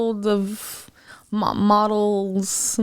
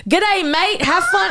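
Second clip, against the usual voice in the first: higher, hammed-up Australian send-off; mock Australian; 10 semitones higher, 5 dB louder than usual. Replying to partner about cousins in Australia, mock Australian accent. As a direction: toss off a sarcastic Australian farewell.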